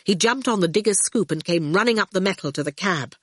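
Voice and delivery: ordinary delivery, nothing marked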